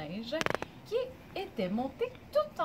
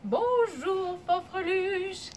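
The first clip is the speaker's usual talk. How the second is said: higher, lilting mum voice, drawn out; mum's middle voice; 7 semitones higher, 3 dB louder, much slower than usual. Shown as a demonstration. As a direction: greet sweetly in a middle-high mum's voice. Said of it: "une belle voix moyenne". (voix de maman, moyenne et chantante)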